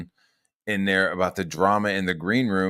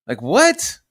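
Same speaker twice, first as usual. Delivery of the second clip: loud, high rising question; baffled outburst with pitch jumping up; incredulous; 11 semitones higher, 8 dB louder than usual. Real, not acted. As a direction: scoff in disbelief. Scoffing at their claim.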